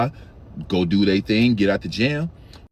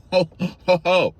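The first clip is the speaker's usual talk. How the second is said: firm hold-on shout; 4 semitones higher, 4 dB louder than usual. Retold or imitated. Telling worker to hold on.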